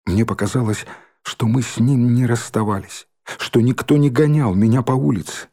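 ordinary delivery

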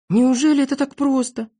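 high-pitched amazed question; Незнайка, surprised. A narrator's reading. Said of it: "удивился Незнайка". (удивлённо)

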